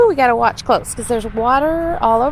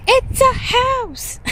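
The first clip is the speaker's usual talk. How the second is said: imitating toddler; 11 semitones higher, much slower than usual. Echoing toddler's words.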